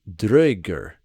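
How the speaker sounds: Draugr with first syllable rhyming with now, very slow; Old Norse pronunciation. Shown as a demonstration. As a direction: stretch out Draugr, DROW-gr, rolled R. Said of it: "rhymes with now".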